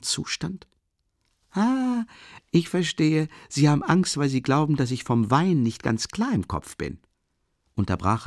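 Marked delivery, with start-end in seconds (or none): 1.5-7.0 put-on raised, eager young man; lightly voiced drunk young man; tipsy Rasumichin. A narrator's reading. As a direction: talk on, a little tipsy and earnest.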